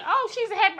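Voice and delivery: high, bright mock cheer; imitating a bubbly person's view; cheery voice. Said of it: "when you see me".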